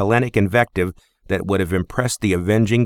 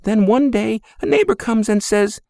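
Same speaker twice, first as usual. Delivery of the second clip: high, tender mother's voice; fond Greek mother; 14 semitones higher than usual. Acted. Mother fondly compares her son to his great-grandfather.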